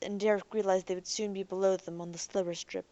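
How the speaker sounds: ordinary delivery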